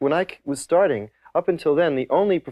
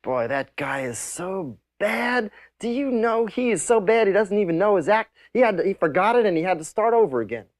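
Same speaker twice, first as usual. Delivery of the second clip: disapproving club owners; 7 semitones higher than usual. Retold or imitated. Nightclub owners complaining about the comedian, high mock indignation. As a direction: imitate baffled owners in a high voice. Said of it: "they would say things like".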